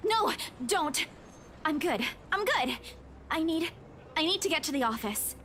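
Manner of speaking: soft, faint dialogue; shaken, brushing off. Acted. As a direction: wave off help weakly. Shaken narrator waves off help.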